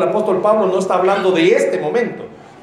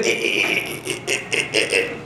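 low, soft flustered eh eh; a hammed-up nervous stammer; awkward stammer; 13 semitones lower, 8 dB quieter, much slower than usual. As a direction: stumble over awkward hesitation noises. Stammering when spotting rival.

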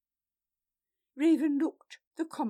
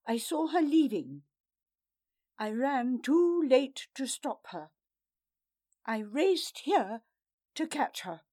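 louder, pressing account; urgent explanation; 3 semitones higher, 6 dB louder than usual. A narrator's reading. Vala explaining breathlessly.

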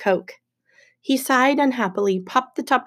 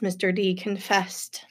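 plain reading voice

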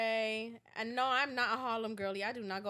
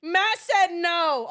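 slow, very loud, higher refusal; loud veto; 4 semitones higher, 13 dB louder, much slower than usual. Real, not acted. Friend repeating Matt's veto on cigarettes, loudly. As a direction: belt out each word to shut it down.

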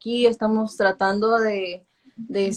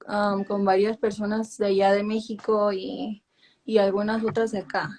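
normal talking voice

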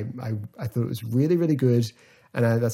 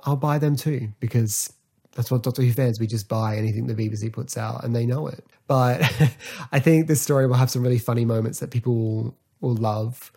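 usual speaking voice

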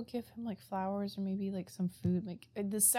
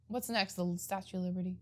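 ordinary talk

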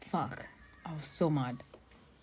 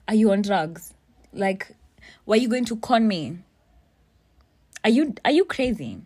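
raised voice, mildly outraged questions; retold angry accusation, getting higher and louder; indignant confrontation; 3 semitones higher, 5 dB louder than usual. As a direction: challenge them in disbelief, pitch climbing. Acted quarrel over the money.

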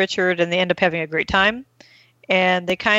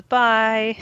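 a hint of tiny child, higher; cute baby bye; 4 semitones higher than usual. Baby saying goodbye to his dad, cute. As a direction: lightly imitate a baby's little bye. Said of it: "it is the cutest little bye".

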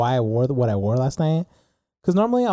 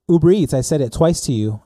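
louder, punched retort, retold; insistent repeat; 3 semitones higher, 5 dB louder than usual. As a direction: repeat it firmly and louder to someone who missed it. Repeating herself after not being heard.

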